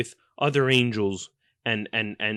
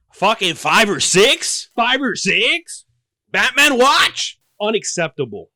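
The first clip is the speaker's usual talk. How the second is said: much higher, louder, slow disbelief; incredulous shout; 8 semitones higher, 7 dB louder, slower than usual. Acted. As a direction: stretch each word out in outrage.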